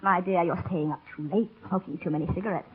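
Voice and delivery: put-on low, soft, fussy old doctor voice; mimicking the old doctor. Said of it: "Oh, poor old befuddled Parsons".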